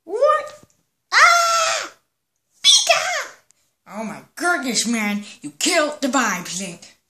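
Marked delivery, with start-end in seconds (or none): 0.0-1.9 shrill startled yelp, much higher and louder; startled cry. Acted. Startled friend cries out in surprise. 2.6-3.3 high squeaky creature call of its name; Pikachu cry. Acted. Pikachu shouts its name, high and loud.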